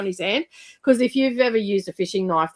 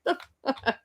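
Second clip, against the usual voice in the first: laughing disbelief; 12 semitones higher, 4 dB quieter, much slower than usual. Real, not acted.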